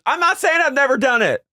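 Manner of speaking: very high imitation of her protest; hammed-up high-pitched retort; mock defensiveness. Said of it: "And she goes".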